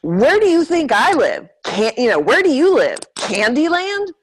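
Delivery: high, loud and animated, no accent; full energy. Shown as a demonstration. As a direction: tell it with all the intensity you've got. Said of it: "having all of the energy".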